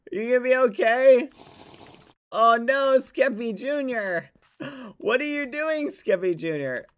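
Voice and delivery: high, loud mock-worried calls; alarmed calling out. Real, not acted. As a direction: call out to a little one who just fell. Calling to fallen game creature.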